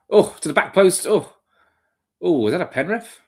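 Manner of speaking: high, startled live commentary; excited match reaction. Real, not acted. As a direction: gasp through a goalmouth scare, pitch jumping up. Live reaction to corner danger.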